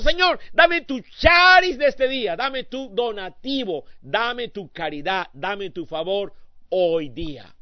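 starts as a high, loud shout, then settles to slow pleading; shouted plea fading to slow. Retold or imitated. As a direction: cry out to God, then ease into a slow plea. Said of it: "llegó el sábado".

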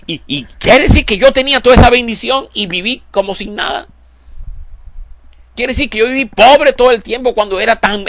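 high, incredulous pleading questions; stunned dismay in heaven. Pretend. Preacher voicing believers arriving in heaven, high, incredulous lament. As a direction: realize too late what you had. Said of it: "vamos a decir".

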